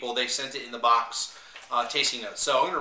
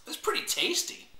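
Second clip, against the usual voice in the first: "It's pretty tasty" squeaked much higher in delight; 14 semitones higher, 3 dB quieter than usual. Real, not acted. Vlogger tasting wine on camera, pleased high squeak. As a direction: pitch "It's pretty tasty" way up, tickled by the flavor. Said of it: "I like when I say it's pretty tasty".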